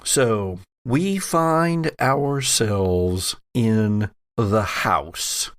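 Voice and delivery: ordinary talk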